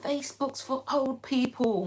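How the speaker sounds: lightly put-on teen scoffing at Facebook; mocking young people. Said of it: "the younger generation are like".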